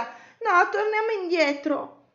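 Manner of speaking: high, urgent plea; frightened. A narrator's reading. Cosmo begs to leave the dark cave, frightened. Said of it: "più Cosmo aveva paura".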